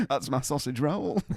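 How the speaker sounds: punchline through a laugh. Retold or imitated. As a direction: land the joke while laughing. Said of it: "You've been waiting to say that".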